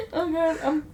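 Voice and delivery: high and loud, laughing through every word; crying with laughter. Real, not acted. Cohost says he's crying with laughter, laughing. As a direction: laugh until the tears come. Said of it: "I'm crying now".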